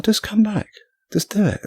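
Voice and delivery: high pleading call to come back; imagined plea. Retold or imitated. Climber imagining a guide calling him back, high and pleading. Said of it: "if someone would have gone".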